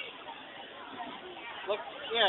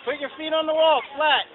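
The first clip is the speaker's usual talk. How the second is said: loud instructions shouted upward; calling up loudly; 8 dB louder than usual. Real, not acted. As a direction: project the directions up the wall.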